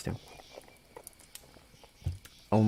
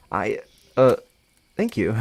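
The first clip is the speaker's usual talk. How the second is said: hesitant, with filler pauses; unsure, halting thanks; 4 dB louder than usual. Acted. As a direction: stumble into a thank-you. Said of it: "not knowing how to go about this".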